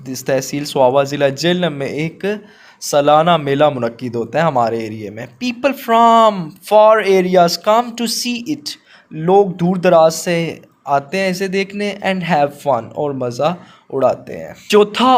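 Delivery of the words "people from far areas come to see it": slow, deliberate dictation pace; slow classroom read-aloud. Shown as a demonstration. Teacher dictates model answer.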